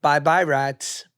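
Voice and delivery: ordinary talk, nothing marked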